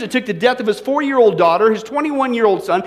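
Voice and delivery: normal talking voice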